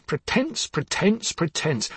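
each pretense falling in pitch; bitter repetition. Acted. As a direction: spit the word out, fading.

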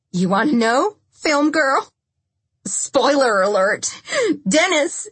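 high, loud, vicious taunt; taunting outburst. A narrator's reading. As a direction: taunt her with the reveal. Lindsay taunts Carrie before the shot.

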